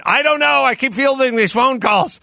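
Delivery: high, exasperated mock excuse; mock harried worker. Swamped worker blaming phone calls to a boss, mock exasperation. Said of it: "none of these people are in a hurry".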